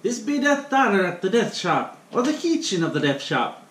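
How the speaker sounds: hammed-up Irish greeting to the viewers; fake Irish accent. Cook greeting viewers in a hammed-up Irish accent. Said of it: "sorry for the fake accent there".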